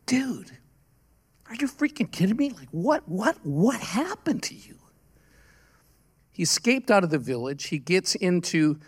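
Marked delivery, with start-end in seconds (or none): 0.1-4.8 high, hushed retold incredulity; stunned disbelief. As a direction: replay being floored by the sight. Retelling his shock at the scars.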